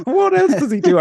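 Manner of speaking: high, loud falsetto impression through a laugh. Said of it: "I can't do his voice. It just turns country".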